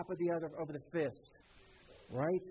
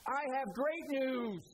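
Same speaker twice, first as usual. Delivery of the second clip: high, drawn-out herald's announcement; mighty angelic proclamation; 4 semitones higher, slower than usual. Shown as a demonstration. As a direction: proclaim it slowly in a mighty, ringing voice. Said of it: "talking in a loud, mighty, God-strengthened voice".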